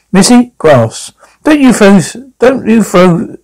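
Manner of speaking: high, sharp scold at the table; mother scolding her daughter for throwing food. Acted. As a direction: tell the kid off.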